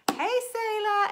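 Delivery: high, loud sung-out "Hey, Selah!"; singsong greeting. Pretend. Greeting the paint color Selah with a loud high call. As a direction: call it out high like a cheery hello. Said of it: "why I say it like that every time".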